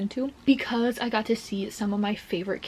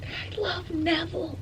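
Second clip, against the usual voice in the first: higher and softer, almost crying; high, tearful adoration; 7 semitones higher, 4 dB quieter than usual. Real, not acted. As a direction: say it again with a catch in the voice. Repeating it, more emotional.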